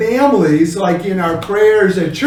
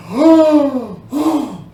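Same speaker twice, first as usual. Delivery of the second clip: shocked gasp; 6 semitones higher than usual. Shown as a demonstration. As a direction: gasp then a high "oh, oh". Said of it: "So it could be like".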